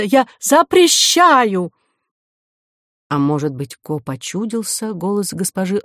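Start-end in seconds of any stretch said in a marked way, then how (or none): 0.0-1.7 high, loud, drawn-out command; shouted ban. A narrator's reading. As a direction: forbid it loudly, stretching the words. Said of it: "крикнула вслед ей директриса". (протяжный запрещающий крик)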